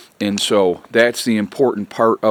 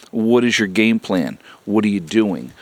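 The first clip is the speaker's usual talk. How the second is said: normal talking voice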